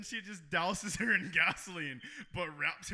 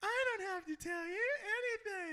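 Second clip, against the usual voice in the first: very high, squeaky mock kid; cartoonish child falsetto; small child's voice; 4 dB quieter than usual. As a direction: play a defiant little kid, pitched way up. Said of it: "do a small child's".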